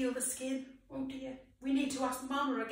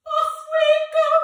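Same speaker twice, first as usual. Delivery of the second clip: loud cue; 13 semitones higher, 12 dB louder, faster than usual. Real, not acted. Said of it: "cue to sing".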